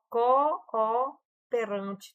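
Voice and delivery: CO-O in "cooperante" drawn out as two separate beats; split double O. Shown as a demonstration. Teacher shows students how the two O's separate, syllable-split "cooperante". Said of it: "Os dois O se separaram aqui".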